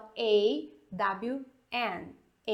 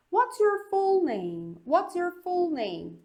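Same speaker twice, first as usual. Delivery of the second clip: higher and slower "What's your full name?"; slow demo question; 7 semitones higher, 3 dB louder, slower than usual. Shown as a demonstration. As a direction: ask it clearly, higher and slow. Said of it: "Então olha aqui".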